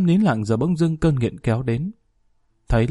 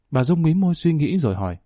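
plain reading voice

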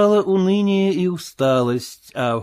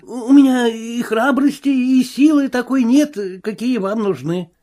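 frightened tramp; 10 semitones higher than usual. Acted. Marvel excusing himself to the invisible man, higher whine. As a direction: beg like a bruised coward.

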